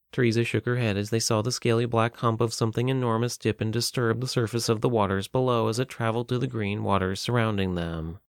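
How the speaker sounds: ordinary delivery, nothing marked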